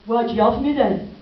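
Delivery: put-on loud, slow, indignant teenage yell; teenage son shouting. Son shouting at his mother during a fight, loud and indignant. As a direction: fling it back like a furious teen. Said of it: "and he said".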